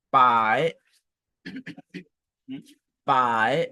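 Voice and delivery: "pai" gliding upward; rising model "pai"; second tone. Shown as a demonstration. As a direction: dip into "pai", then lift it. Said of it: "le deuxième ton maintenant".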